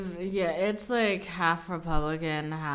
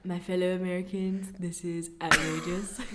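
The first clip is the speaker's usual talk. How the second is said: Donald Trump impression; 4 dB quieter than usual. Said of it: "try doing a Donald Trump".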